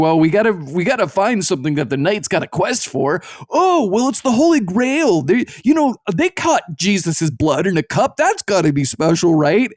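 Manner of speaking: excited poet. Retold or imitated. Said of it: "who were just like".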